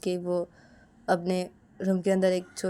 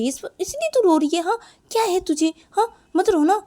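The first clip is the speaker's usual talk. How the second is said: loud, high, exasperated comforting; insistent, raised-voice sisterly fussing; chiding big sister; 8 semitones higher, 9 dB louder than usual. Acted. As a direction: scold her out of crying. Big sister chides the crying girl.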